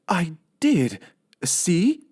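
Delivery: high, slow and pleased; high housemaid musing to herself; high, sweet Amelia Bedelia voice. Acted. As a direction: play the cheerful literal maid. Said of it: "said Amelia Bedelia".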